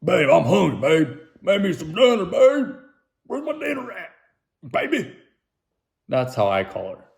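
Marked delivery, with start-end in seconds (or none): 0.0-5.2 high, loud whiny demands; husband nagging wife for dinner, high whiny voice; demanding husband. Pretend. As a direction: play a spoiled husband whining for dinner. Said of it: "but I'm like".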